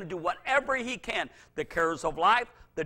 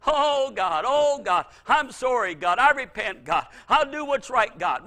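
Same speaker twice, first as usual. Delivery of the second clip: pleading cries to God, higher and louder; mock desperate repentance; 3 semitones higher, 6 dB louder than usual. Preacher mimicking a sinner's panicked prayer to God, higher and louder. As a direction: ham up a frantic sinner begging God. Mocking believers' frantic repentance.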